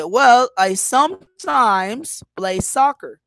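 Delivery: slow answer with "sometimes" stretched out, "soccer" secondary; stress on SOMETIMES. Shown as a demonstration. Answering how often you play soccer, SOMETIMES emphasized. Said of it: "I am emphasizing the adverb of frequency".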